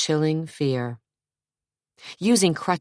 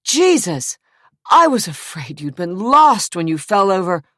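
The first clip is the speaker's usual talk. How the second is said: higher, louder show of relief; faked relief; 4 semitones higher, 6 dB louder than usual. A narrator's reading. Jason to his brother on the yacht, loud faked relief. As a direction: pretend to be glad he's alive. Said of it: "forced himself to sound relieved".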